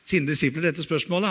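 ordinary talk, nothing marked

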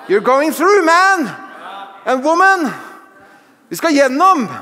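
high, loud exhortation with a laugh; rousing preaching. Real, not acted. Preacher encouraging every man and woman, loud and high. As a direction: cheer them on.

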